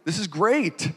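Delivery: high, bubbly, loud mock-giddy angel; excited angel. The angel giddily telling Mary the news, high and loud. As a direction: gush the news like it's a party. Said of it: "he says".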